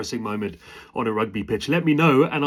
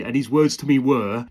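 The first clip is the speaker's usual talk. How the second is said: plain reading voice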